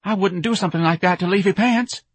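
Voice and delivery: high, anxious piping; frightened protest. A narrator's reading. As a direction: plead innocence in a high voice. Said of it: "His lips were quivering".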